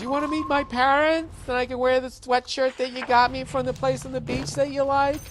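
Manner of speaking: high sing-song mock girlfriend; girlfriend's voice. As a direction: put on a high, eager girlfriend voice. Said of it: "You know, when she goes".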